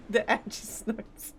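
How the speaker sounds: ordinary talk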